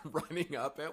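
normal talking voice

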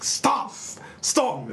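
high, shouted American stop; American accent shout. Shown as a demonstration. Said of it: "in American accent".

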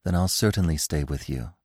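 soft, low, tender promise; gentle. A narrator's reading. Feline agreeing to stay with Bambi, gentle. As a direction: reassure him softly and low. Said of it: "said Feline gently".

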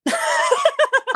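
laughing. Real, not acted. Teasing friend about closet.

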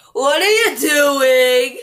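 slow, loud demand; insistent question. Pretend. Louder repeat of the question.

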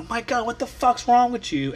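imitating the girlfriend, high and exasperated; annoyed girlfriend. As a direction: play the rudely woken girlfriend, voice pitched high. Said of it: "So I had to hear my girlfriend".

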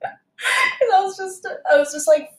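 high, helpless laughter through the words; laughing. Real, not acted. Waitress recalling being full-on angry, laughing. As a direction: try to speak while cracking up.

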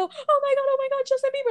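very high, overwhelmed fangirl; hammed-up high-pitched squealing; mock starstruck squeal. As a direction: imitate a fan about to swoon. Imitating starstruck girls before they faint.

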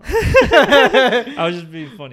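laughing it off, slow and loud. Real, not acted. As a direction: laugh through the words, just kidding. Laughing at his own joke.

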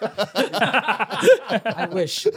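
says it through a laugh. Real, not acted. Laughing reply to a joke.

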